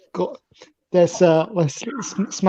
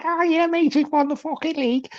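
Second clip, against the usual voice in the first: put-on much higher, softer Everton fan accent; mock Everton fan accent; 9 semitones higher, 6 dB quieter than usual. Everton fans claiming the league early, higher Liverpool accent. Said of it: "That's a quality accent as well".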